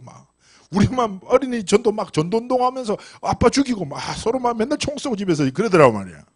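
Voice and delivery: ordinary delivery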